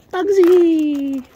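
high, loud sing-song call; calling the bird. Real, not acted.

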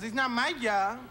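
put-on light Puerto Rican accent, dismissive; Puerto Rican manager. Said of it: "He goes".

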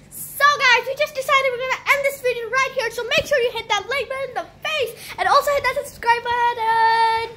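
loud, fast, upbeat outro; vlog outro. Acted. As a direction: pump up the sign-off.

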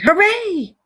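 high, jubilant cheer; triumphant cry. A narrator's reading. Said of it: "Suddenly the Rat cried".

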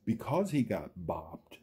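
lively, sing-song storybook read; reading to children. Acted. Reading a picture book aloud to young viewers, sing-song. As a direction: build each chain link like a bedtime story.

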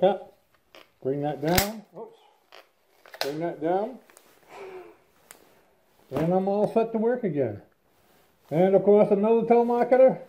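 muffled behind respirator; speaking inside rubber mask; through a face mask. Real, not acted. Seen 5: Mask pulled over mouth and nose, worn through labelled words.

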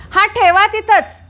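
loud, high and slow call to the door; slow loud call across the house. Real, not acted. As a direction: tell them from afar to leave it there. Calling out to maid at door.

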